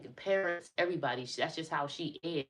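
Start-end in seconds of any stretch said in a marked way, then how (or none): none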